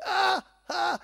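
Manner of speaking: high, loud mock scream of pain; imitated cry of pain. The uncle at the barber's, mock howl of back pain. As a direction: shout out like a blocked back. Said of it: "il hurle de douleur".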